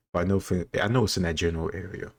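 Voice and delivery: normal talking voice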